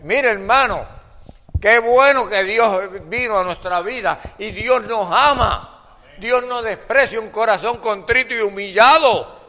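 high, loud, fervent exhortation; full-force preaching. Real, not acted. Preacher telling complainers that God loves them, full-force preaching. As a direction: lift the voice and preach with urgency.

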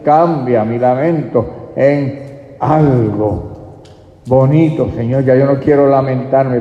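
loud, pleading preacher's appeal; preacher leading a loud penitent prayer; fervent altar call. Acted. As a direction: urge them back to God.

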